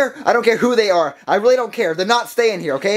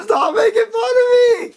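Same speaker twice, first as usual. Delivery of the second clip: much higher, slow, crying shout; crying whine; 12 semitones higher than usual. Acted. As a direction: wail it through tears at the teasing. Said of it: "he'll start crying".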